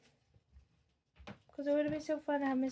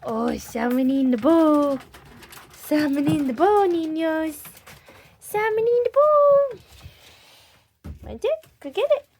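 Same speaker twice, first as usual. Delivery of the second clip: doting pet voice; 4 semitones higher, 11 dB louder than usual. Real, not acted. Owner calling her ferrets to the salmon, high sing-song baby talk. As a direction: coo over the ferrets like babies.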